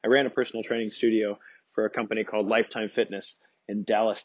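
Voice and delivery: usual speaking voice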